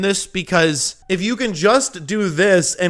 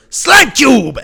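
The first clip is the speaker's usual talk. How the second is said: higher, louder hammed-up shout; mock battle cry; 8 semitones higher, 8 dB louder than usual. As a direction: toss it off like a goofy war cry. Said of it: "he goes".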